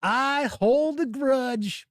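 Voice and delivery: I hold a grudge stretched slow and high; drawn-out grudge line. Shown as a demonstration. Host repeating his confession, slow, high and hammed up.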